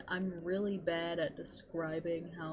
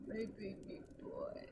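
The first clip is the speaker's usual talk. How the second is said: whispered coo; 8 dB quieter than usual. Real, not acted. Gushing over her baby boy.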